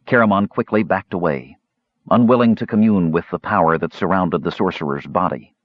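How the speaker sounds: plain reading voice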